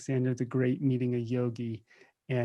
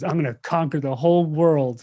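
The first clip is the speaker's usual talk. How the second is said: boastful Alexander; 3 semitones higher, 8 dB louder than usual. Retold or imitated. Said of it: "And Alexander the Great goes".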